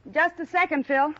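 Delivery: high, loud call toward the door. Acted. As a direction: call through to someone outside. Calling out to visitor at door.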